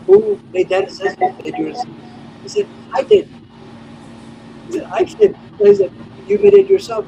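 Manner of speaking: animated retelling. Retold or imitated. Retelling both sides, raised voice.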